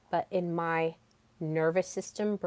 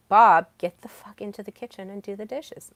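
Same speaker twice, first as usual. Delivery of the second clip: louder opening on the name; half-joking bossy demand; mock blunt order; 8 dB louder than usual. Telling coworker Bob to do the dishes, mock blunt order. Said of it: "Maybe I don't say it like that".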